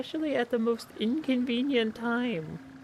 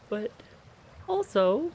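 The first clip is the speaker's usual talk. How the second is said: ordinary talk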